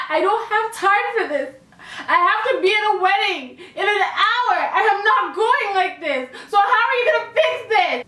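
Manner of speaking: high, indignant scolding; imitating an irate customer, laughing through it; snapping client.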